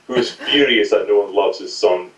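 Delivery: usual speaking voice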